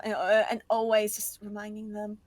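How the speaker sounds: ordinary talk, nothing marked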